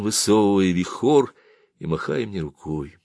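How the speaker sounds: ordinary delivery, nothing marked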